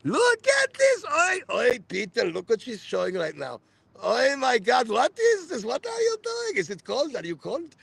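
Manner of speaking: high, excited mock mother voice; mother's high, shrill-free excited chatter, fading at the end; hysterical mother. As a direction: gawk and shout like a hysterical mum. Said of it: "I'm just repeating my mother".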